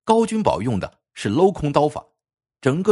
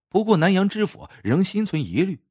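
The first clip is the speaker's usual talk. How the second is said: usual speaking voice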